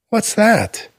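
put-on high, bright child's question; curious little boy. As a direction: ask it like an eager four-year-old. Curious boy points again.

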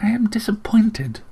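high, hushed disappointment; restrained regret. A narrator's reading. Aunt rebukes her nephew, immense restraint and regret. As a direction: hold back, let regret show. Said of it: "a tone that implied immense restraint and regret".

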